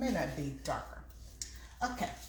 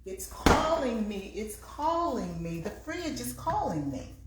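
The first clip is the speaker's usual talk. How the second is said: playful sing-song; 6 semitones higher than usual. Pretend. Fridge calling, playful sing-song.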